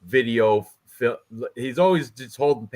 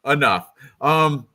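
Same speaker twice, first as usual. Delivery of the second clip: ordinary talk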